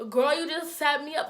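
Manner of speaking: mock-indignant complaint; accusing. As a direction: act out feeling betrayed by a friend. Rival accuses the neighbor.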